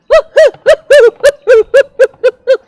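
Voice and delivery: shrill, very high cartoon duck cackle; loud daffy hoo-hoo laugh; wacky duck laugh.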